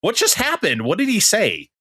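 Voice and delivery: high, confused mock exclamation; baffled viewers. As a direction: play bewildered viewers who missed the line. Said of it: "everyone being like".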